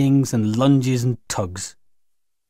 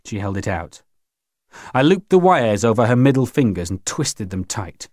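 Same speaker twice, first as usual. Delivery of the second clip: ordinary delivery, nothing marked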